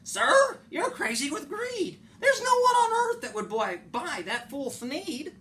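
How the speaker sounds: very high, loud outraged cry; the Lorax scolds the greedy Onceler, very high and loud; indignant Lorax. Acted. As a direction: scold him for his greed. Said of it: "The Lorax said".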